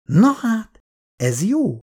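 lightly nervous, high Na hát; a touch of nervous irritation; wife a bit rattled by husband's story, mildly nervous. A narrator's reading. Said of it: "mondta Irén kissé idegesen".

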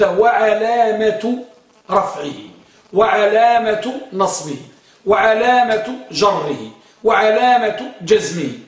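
وعلامة drawn out slowly before each ending; slow, deliberate emphasis on each وعلامة; stress on وعلامة. Shown as a demonstration. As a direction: stretch وعلامة to bring out its ع. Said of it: "فيه العين".

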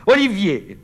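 high, loud call after him; desperate cry. Acted. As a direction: cry out his name as he leaves. (appel désespéré, aigu et fort)

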